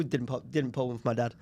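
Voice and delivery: ordinary talk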